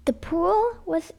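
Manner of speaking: lively sing-song read-aloud; storybook reading. Acted. Mum reading a picture book to kids, lively. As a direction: read it like bedtime story time.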